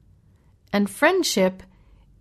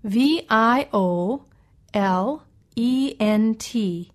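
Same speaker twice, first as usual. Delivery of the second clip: spelled out; much slower than usual. Shown as a demonstration. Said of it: "spells the word aloud".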